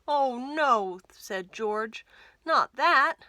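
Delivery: hammed-up despair; mock dismay. George dismayed at Martha's silent treatment.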